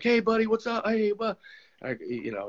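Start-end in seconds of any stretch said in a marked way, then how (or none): 0.0-1.4 much higher, bright mock greeting; mock-friendly former teammate. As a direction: greet me in a put-on high chummy voice. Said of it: "he's like".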